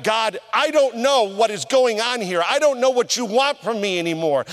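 high-pitched, frustrated plea; exasperated and pushed up high; angry at God. Retold or imitated. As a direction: retell a heated argument with God. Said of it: "I was angry".

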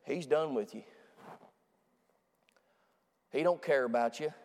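low, quiet taunting devil voice; a hushed, menacing devil; devil's taunt. Retold or imitated. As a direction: whisper the devil's lie low and soft. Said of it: "the devil's gonna come right there and he say".

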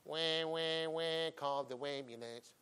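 slow, hammed-up wailing; mocking baby Christians as crybabies; mock baby cry. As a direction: whine like a crying infant. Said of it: "little baby Christians".

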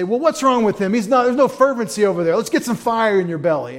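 loud, high scolding of a calm believer; mock scolding. Fervent believer scolding the calm one, loud and high. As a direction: bark at him to get fired up. Judging the calm John type.

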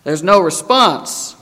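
usual speaking voice

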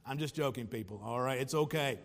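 low, soft aside after a chuckle; joking aside. Real, not acted. Preacher reassures congregation after a sports joke, low soft aside. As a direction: drop low and quiet to take back the tease.

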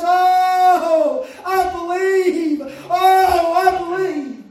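loud, drawn-out wail of faith; crying out, imitated. Imitating a tearful father answering Jesus, loud anguished cry. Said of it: "The Bible said he cried out".

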